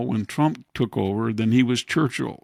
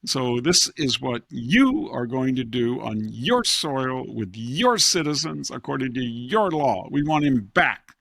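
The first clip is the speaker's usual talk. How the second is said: 1 pompous imperialist; 2 semitones higher than usual. Retold or imitated. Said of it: "and they say".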